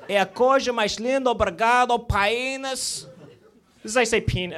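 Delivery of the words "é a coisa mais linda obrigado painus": high-pitched Portuguese patter; mock Portuguese. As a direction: lilt it high in a put-on Portuguese accent. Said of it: "in Portuguese".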